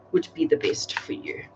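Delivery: breathy mystical whisper of the question; hushed whisper, as if asking the deck. Acted. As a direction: whisper it to the cards. Question repeated, then whispered.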